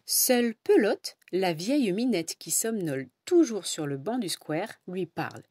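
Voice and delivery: ordinary delivery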